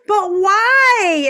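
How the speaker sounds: mock-pleading; plaintive protest. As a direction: protest as the rejected beer cans. Joke: beer cans protest.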